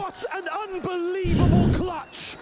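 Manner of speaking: high, loud, stretched shout; triumphant yell. Real, not acted. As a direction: scream the clutch call. Caster screams the clutch.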